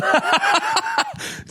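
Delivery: high-pitched burst of laughter. Real, not acted. Confessing a suspension to a friend, high laugh. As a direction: laugh at yourself.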